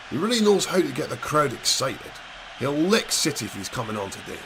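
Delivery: usual speaking voice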